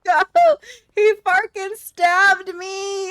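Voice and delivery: high, loud, slow outraged yell. Real, not acted. Gamer yelling after a teammate stabs him in the game. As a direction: howl it like you've been betrayed.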